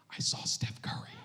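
put-on hushed, breathless little girl; awed child whisper. Daughter telling dad she saw Steph Curry, an awed whisper. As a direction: whisper it in awe like a starstruck kid. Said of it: "she goes".

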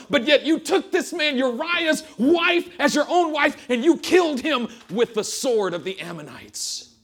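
loud, high building rebuke; the Lord listing his gifts; God thundering at David; divine rebuke. Acted.

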